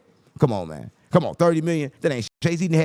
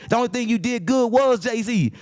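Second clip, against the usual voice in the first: usual speaking voice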